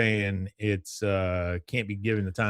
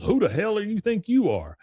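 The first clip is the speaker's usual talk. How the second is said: higher-pitched, mock-indignant challenge; playacted who-are-you demand; Elvin's challenge; 10 semitones higher than usual. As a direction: confront a stranger, retold with raised pitch. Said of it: "and he goes".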